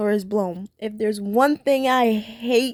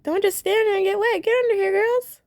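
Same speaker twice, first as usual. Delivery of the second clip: high, kind cartoon tiger voice with her accent; motherly tiger calling the girls under the umbrella, high and sweet in her accent; 10 semitones higher than usual. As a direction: coax the girls in like a doting aunt, in her accent. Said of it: "I love her accent".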